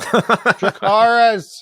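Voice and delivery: long, stretched-out theatrical call; mock desperate command. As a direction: draw the command out as a hammed-up plea. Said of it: "fucking twenty times for him".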